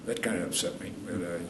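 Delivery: usual speaking voice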